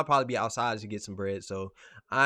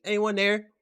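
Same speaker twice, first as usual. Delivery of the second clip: mock impatient customer; 11 semitones higher, 8 dB louder, slower than usual.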